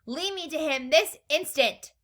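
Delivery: high, loud, imperious order; snapped. A narrator's reading. Mother orders Wonka to take her, snapped. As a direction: demand it at once. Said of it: "snapped Missus Gloop".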